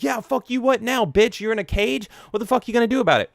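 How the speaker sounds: mock trash talk from a cocky teen; taunting kid. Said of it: "he's just like".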